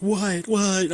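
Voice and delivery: put-on the boy yelping in fear at his dad; retold panic. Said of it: "I'm freaking out".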